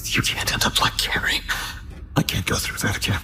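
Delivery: hushed, haunted aside; troubled whisper. Acted. Captor dreading a repeat of Carrie, troubled whisper. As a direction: whisper it as if to yourself. Captor frets over an earlier victim.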